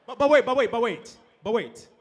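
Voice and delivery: urgent excited calling out; but wait, loud and rushed. Real, not acted. Stops the trick in excitement.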